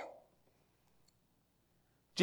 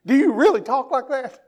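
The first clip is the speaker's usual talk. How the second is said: higher, laughing disbelief; amused waitress; 5 semitones higher than usual. Retold or imitated. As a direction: tease the Southern accent while laughing. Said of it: "the waitress looked at him and said".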